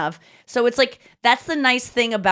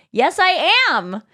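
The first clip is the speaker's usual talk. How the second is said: slow, higher-pitched retold insistence; insistent claim; 7 semitones higher, 3 dB louder, slower than usual. Comedian announcing herself to the doubting couple, insistent. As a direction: draw it out higher so they finally get it. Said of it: "then she turns around and go".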